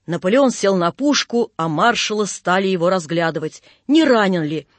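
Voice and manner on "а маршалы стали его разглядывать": ordinary delivery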